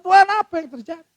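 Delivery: high, loud pleading outcry; pleading shout heavenward; anguished cry to God. Retold or imitated. Pastor crying out to God.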